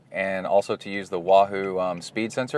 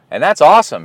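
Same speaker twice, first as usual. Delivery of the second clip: excited; 7 semitones higher, 10 dB louder, faster than usual. Real, not acted. Proud of son's progress.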